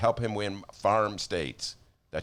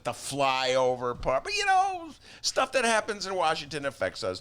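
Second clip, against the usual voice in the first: usual speaking voice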